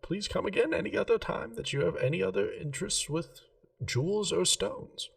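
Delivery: a hint of a prim gem-shop lady, keen on geometry. [name] bids goodbye.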